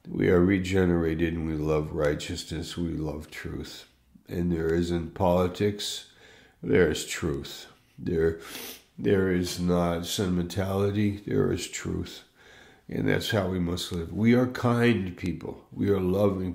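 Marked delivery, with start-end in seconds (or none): none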